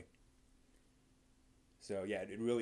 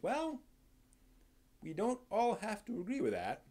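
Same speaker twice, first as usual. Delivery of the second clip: high mock retort; 8 semitones higher than usual.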